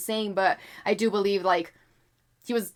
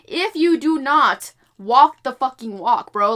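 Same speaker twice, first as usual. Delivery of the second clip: frustrated; 3 semitones higher, 8 dB louder than usual. Real, not acted. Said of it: "I'm a little frustrated".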